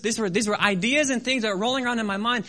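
ordinary delivery

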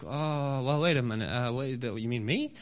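low, faltering retold reply; hesitant disbelief. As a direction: stammer it out, small and unsure. Stammering, doubtful answer to the Lord.

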